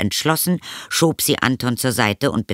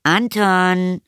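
high, drawn-out motherly call; rufende Mutter; 9 semitones higher than usual. A narrator's reading. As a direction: call out to your son in a high voice. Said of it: "rief die Mutter". (rufende Mutterstimme, hoch)

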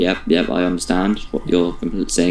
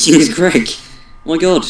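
laughing; 8 semitones higher than usual. Real, not acted. Laughing high.

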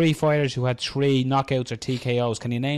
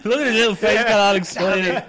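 teasing laugh; 7 semitones higher, 6 dB louder than usual. Real, not acted. Teasing friend's eager guess.

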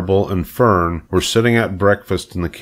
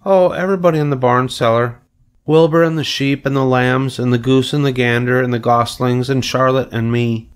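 put-on higher, chatty little girl; Fern; 6 semitones higher, 3 dB louder than usual. A narrator's reading. Fern lists the barn friends for her father, child voice. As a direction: list them all in a child's voice.

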